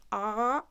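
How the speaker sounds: "ah" with the tongue pulled too far back. Shown as a demonstration. Teacher demonstrates pulling too far, retracted "ah". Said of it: "if you pull your tongue too far".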